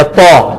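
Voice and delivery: "تو" with a heavy Arabic t; full, emphatic t in "تو"; thick t. Shown as a demonstration. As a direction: round the tongue and fatten "تو". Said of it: "تھوڑا موٹا ہو جائے گا".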